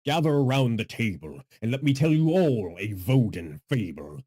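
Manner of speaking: loud, projected storyteller intro; big theatrical fable-teller. Pretend. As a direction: boom out the opening of a fable. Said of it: "I am now using my deeper voice".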